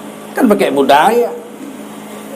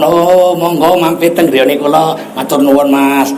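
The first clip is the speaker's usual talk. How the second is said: lower, courteous Javanese accent; 10 semitones lower than usual. Shown as a demonstration. Javanese villager invites a stranger home, low polite Javanese accent. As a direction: invite them in with a gentle Javanese lilt. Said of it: "pakai logat Jawa".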